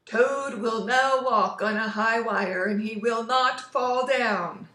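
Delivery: loud, slow, grand presenter voice; booming far-off announcer. Acted. Strange voice presents Toad in his dream, booming announcer. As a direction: announce him like a showman. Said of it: "said the voice".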